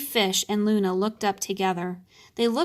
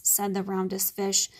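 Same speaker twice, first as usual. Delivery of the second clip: plain reading voice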